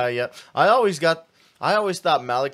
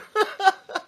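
high laugh; 4 dB louder than usual. Real, not acted. Laughing after the bit.

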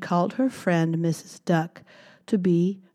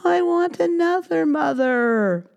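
higher, whiny demand; whiny baby mouse; 11 semitones higher, 3 dB louder than usual. Acted. Baby mouse demands a new mother, high whine. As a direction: whine it like a sulky child. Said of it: "cried Baby Mouse".

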